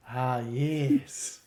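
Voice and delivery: creepy forties film character voice; creepy old-movie guy. Acted. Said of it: "like, the creepy guy from, like, old nineteen forties movies".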